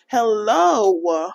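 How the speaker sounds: normal talking voice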